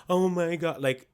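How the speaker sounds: ordinary delivery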